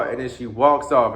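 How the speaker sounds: ordinary delivery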